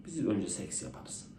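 soft, slow Frenchman voice. Acted. Frenchman's reply in joke.

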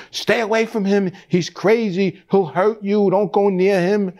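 mother warning her son off a dangerous man; frightened mother. Retold or imitated. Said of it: "she would say".

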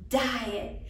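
ordinary talk

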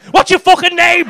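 hammed-up fed-up comic demanding a name; mock fuming. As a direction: bark the question in fake fury. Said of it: "I'm fuming here".

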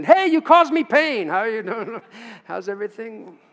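high, loud hammed-up hello that slides into a laugh; playful shouted welcome fading to laughter; mock greeting. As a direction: cheerfully greet someone named after pain. Mock greeting named 'pain'.

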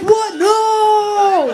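high-pitched, drawn-out protest; horrified shout. Acted. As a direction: cry out in acted horror. Partner's horrified reaction, acted.

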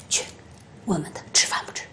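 压低声音悄悄吩咐; 背着孩子轻声交代; 小声吩咐. Acted. 奶奶低声吩咐. (奶奶对儿媳悄声吩咐)

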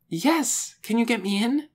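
high, excited plea; eager. A narrator's reading. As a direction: jump at the chance, pitched high. Lena eagerly asks to come.

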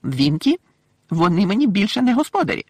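high, loud defiant elf; mildly challenging little elf. A narrator's reading. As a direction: stand up to her in a squeaky voice. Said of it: "виклично сказав Добі".

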